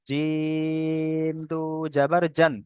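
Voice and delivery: জিম held very long on an even tone; three-alif stretch. Shown as a demonstration. Teacher demonstrating the long letter জিম, drawn-out recitation. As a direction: slowly stretch the "ই" of জিম before দু জাবার জান. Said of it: "জিমটা তিন আলিফ পরিমাণ টান".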